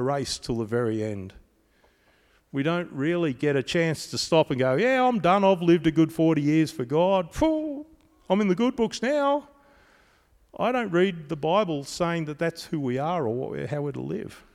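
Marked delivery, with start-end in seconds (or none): 4.7-9.5 high, lazy mock voice of a believer calling it quits; complacent quitter. Preacher mocking a believer who quits on God, high and smug.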